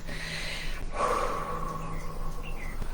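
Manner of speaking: a long unvoiced sigh out; long breath out. Teacher leading kids in a long calming sigh. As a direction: breathe it all out slowly.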